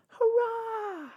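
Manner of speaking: very high, loud hurrah; a crowd cheering from the wharf. A narrator's reading. Wharf crowd cheering the little boat, high and loud. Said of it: "the people on the wharf shouted".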